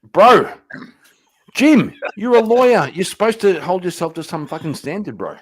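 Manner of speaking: loud, high outraged protest. Real, not acted. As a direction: scold a friend in shock. Outraged at lawyer's joke.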